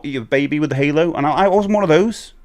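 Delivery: usual speaking voice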